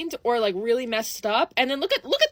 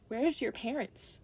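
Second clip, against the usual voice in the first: slow, much softer, controlled demand; 10 dB quieter, slower than usual. Retold or imitated. Confronting a screaming child in the store, quiet slow demand. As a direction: ask it slowly and quietly, with restraint.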